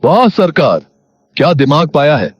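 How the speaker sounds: butter up the boss, loudly; gushing henchman fawning over his master; fawning praise. Acted. Henchman flatters the zamindar's plan.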